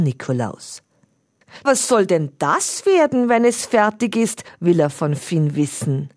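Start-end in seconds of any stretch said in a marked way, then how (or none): 1.6-4.4 curious Onkel Alvin. A narrator's reading. Curious uncle asks.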